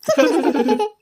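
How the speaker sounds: loud, high burst of laughter; mocking laughter. Others laughing at the uncle, high and loud. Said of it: "ആരും ചിരിക്കൊന്നും വേണ്ട".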